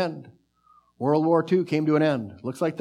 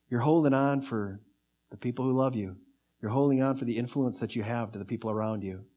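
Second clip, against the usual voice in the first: normal talking voice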